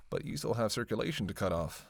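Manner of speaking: normal talking voice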